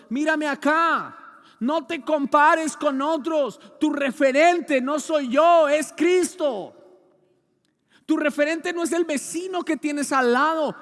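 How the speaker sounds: loud, slow preaching; forceful preaching. Acted. Preacher exhorting the church, slow and loud. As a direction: drive each word home to the congregation.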